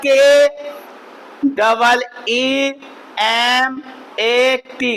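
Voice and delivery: very slow, letter by letter; slow spelling. Shown as a demonstration. Teacher spelling कीमत for pupils, very slowly. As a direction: drag out each letter so nobody misses it. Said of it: "क्या हो जाएगा".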